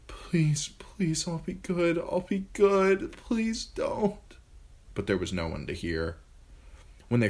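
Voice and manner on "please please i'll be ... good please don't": high, pleading murmur, half whispered; a frightened girl begging in her sleep. A narrator's reading. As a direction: beg in a small terrified voice. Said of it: "She woke murmuring".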